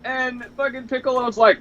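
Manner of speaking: usual speaking voice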